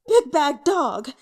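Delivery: loud, slow, high insult; angry shout. Acted. Young man shouting curses up the stairs. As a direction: bellow each word in rage. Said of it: "still shouting with anger".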